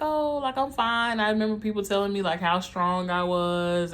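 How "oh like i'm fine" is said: high, bright-faced brush-off, pitched way up; fake fine. Brushing off people checking in, falsely cheerful and much higher. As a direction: chirp it like nothing's wrong. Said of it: "I'd be like".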